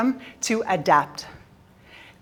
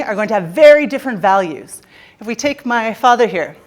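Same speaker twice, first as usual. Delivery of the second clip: ordinary delivery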